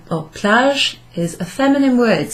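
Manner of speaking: ordinary delivery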